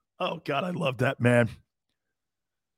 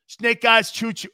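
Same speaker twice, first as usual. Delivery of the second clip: ordinary talk, nothing marked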